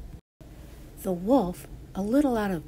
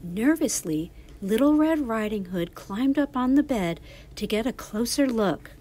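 plain reading voice

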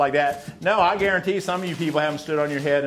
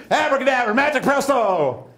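magic spell; 8 semitones higher, 5 dB louder than usual. Pretend. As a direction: cast it like a stage magician. Magician's incantation over volunteer.

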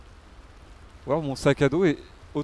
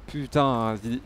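ordinary talk, nothing marked

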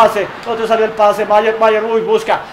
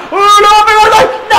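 excited shout; 10 semitones higher, 7 dB louder than usual. Real, not acted. Goal scored, commentator shouts.

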